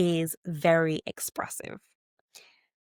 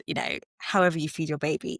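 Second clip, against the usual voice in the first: ordinary delivery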